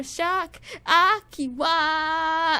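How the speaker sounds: drawn-out singer call. Retold or imitated. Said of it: "then he does".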